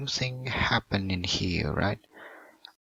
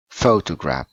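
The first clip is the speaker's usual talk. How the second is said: "photograph" punched loud on "pho", falling after; stress on first syllable; 3 semitones lower, 6 dB louder than usual. Shown as a demonstration. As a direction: hit the opening "pho" of "photograph" hard. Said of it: "on the first syllable".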